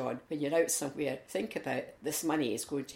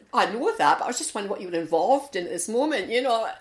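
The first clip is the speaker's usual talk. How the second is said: loud, fast, flustered cover-up; 6 semitones higher, 9 dB louder than usual. Retold or imitated. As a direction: blurt it out to save face. Flustered politician covers mistake.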